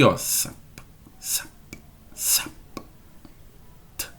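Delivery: soft, broken sap, sap, t with no voice; voiceless consonants demo. Shown as a demonstration. Teacher isolates voiceless s, p, t for students. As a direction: clip sap with no buzz. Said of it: "Nem o S".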